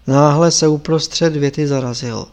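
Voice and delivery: usual speaking voice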